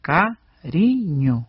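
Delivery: "cariño" drawn out as ca-ri-ño; slow teaching demonstration of "cariño"; syllable by syllable. Shown as a demonstration. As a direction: stretch each syllable of "cariño" slowly. Repeats the word in syllables.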